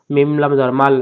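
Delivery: usual speaking voice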